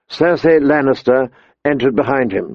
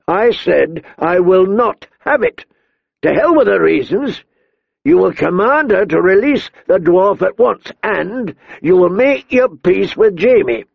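angry command; 7 semitones higher than usual. A narrator's reading. King commands Ned angrily.

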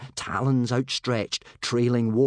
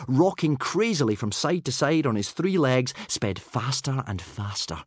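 ordinary delivery, nothing marked

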